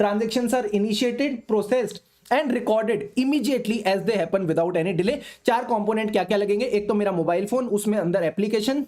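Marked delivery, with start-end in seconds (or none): 0.0-5.1 slow dictation. Shown as a demonstration. Repeating definition as dictation.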